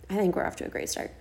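quick, soft, half-whispered reassurance; hushed aside. Real, not acted. Host reassuring herself about the start, hushed aside. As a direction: rush it under the breath.

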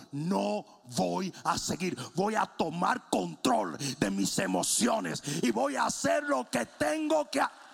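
slow, emphatic, rising to a shout; building resolve. Retold or imitated. David resolves to take control, slow declaration building to shout.

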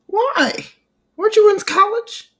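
high, incredulous questions; disbelieving, voice shooting up; surprised. Real, not acted.

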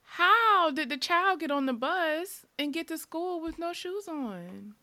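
high, outraged disbelief; incredulous. Real, not acted. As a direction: ask it in shocked disbelief. Outraged question about shoeless kids.

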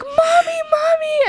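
put-on high, crying out Mommy like a thrilled small child; excited child calling. Little girl at Christmas calling her mom, high and thrilled. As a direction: squeal for mom like a kid who heard Santa.

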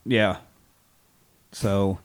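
ordinary talk, nothing marked

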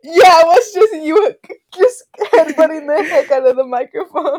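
high, loud, laughing through words; can barely speak for laughing; laughing hard. Real, not acted. Laughing about headbutting the mic.

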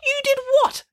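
very high-pitched outraged question; accusing. A narrator's reading. Judith accusing Joseph over the manger, accusing. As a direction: squeak it out in disbelief. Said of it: "accused Judith Piggins".

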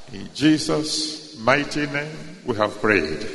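reverent, measured thanksgiving prayer. Acted.

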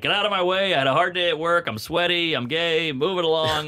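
acted impatient tirade; coming in hot. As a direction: brush everyone aside as a worked-up dad. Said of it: "he would come in hot".

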